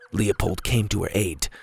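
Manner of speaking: ordinary delivery, nothing marked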